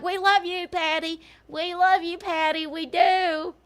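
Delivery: high, loud sing-song fan gushing; mock adoring fans. Mocking fans cooing at her.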